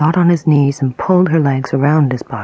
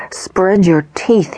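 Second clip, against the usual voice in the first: slow, louder, higher command; screamed; 4 semitones higher, 4 dB louder, slower than usual. A narrator's reading. Attacker yelling an order at the woman, slow and loud. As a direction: shout it slowly, each word hit. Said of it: "he screamed".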